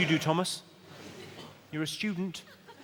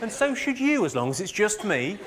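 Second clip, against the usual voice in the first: lightly voiced boyfriend, higher pitched; mock persuasive boyfriend; 7 semitones higher than usual. Boyfriend convincing her they need no relationship, mock persuasive. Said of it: "he's convinced you that".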